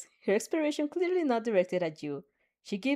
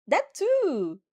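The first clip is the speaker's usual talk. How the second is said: excited; 6 semitones higher than usual. Acted. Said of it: "Hakuri excitedly nods".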